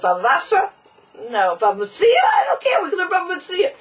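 high, eager mock-Yiddish accent; thick Yiddish accent. Chassid offering tractates to learn by phone, excitable Yiddish accent. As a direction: pitch it way up in a hammed-up Yiddish accent. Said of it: "in the stick Yiddish accent".

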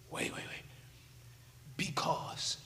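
soft unvoiced whisper. Real, not acted. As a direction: hush them to hear it. Building suspense on the word.

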